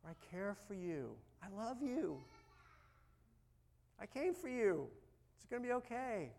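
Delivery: soft, tender, comforting voice; gentle reassurance. Acted. Jesus saying cast your anxieties on me, gently. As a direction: soothe them gently, unhurried. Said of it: "constantly just gently saying".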